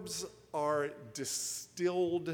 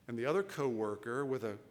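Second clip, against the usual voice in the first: normal talking voice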